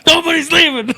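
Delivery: high-pitched, laughing rant in imitation; retold rant squeezed high through laughter; worked-up comedian. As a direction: imitate a comic cracking himself up as he rants. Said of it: "he would get himself worked up".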